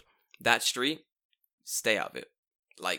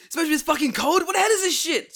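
much higher, much louder mock chef rage; Gordon Ramsay yell; 10 dB louder than usual. Parodying the chef to the co-host, hammed-up yell. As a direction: bellow at the cold soup. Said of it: "it's Gordon Ramsay".

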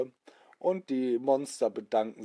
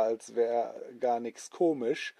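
plain reading voice

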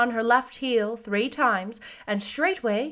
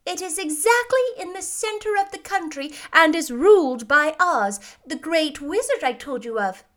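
put-on high, emphatic old lady voice; kindly old witch; 7 semitones higher, 4 dB louder than usual. A narrator's reading. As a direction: advise the child firmly, pitched up.

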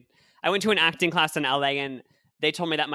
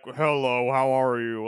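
mock old-fashioned male voice; 2 semitones lower, slower than usual. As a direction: imitate the men in old films. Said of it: "all the guys were like".

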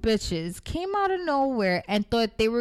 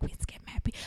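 hushed, unvoiced whisper; secretive whisper admitting the skip; mad quiet; 11 dB quieter, faster than usual. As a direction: whisper it so no one hears. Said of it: "she did a mad quiet".

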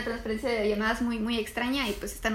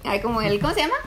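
ordinary talk

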